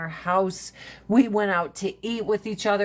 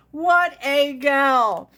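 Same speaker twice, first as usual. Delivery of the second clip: fond; 5 semitones higher, 5 dB louder, much slower than usual. Real, not acted.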